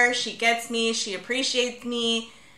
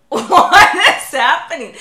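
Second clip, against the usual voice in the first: loud, drawn-out disbelief through laughter; mock bewilderment; 5 semitones higher, 12 dB louder, slower than usual. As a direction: laughingly act out total confusion. Acted reaction to text abbreviations.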